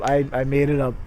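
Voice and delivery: ordinary delivery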